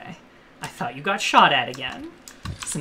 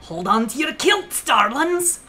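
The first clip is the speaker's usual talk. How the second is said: put-on Scottish accent; 10 semitones higher than usual. Game banter, put-on Scottish flair.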